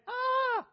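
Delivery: short high mock scream of fright; fearful cry. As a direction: cry out in sudden alarm. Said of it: "they're just saying".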